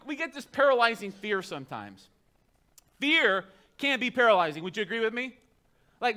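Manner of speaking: normal talking voice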